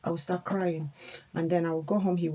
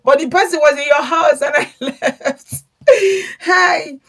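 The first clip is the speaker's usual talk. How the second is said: loud, high laughter through the words; laughing loudly at how absurd it was; 9 semitones higher, 12 dB louder than usual. Real, not acted. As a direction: laugh helplessly while telling it. Laughing recounting the betrayal.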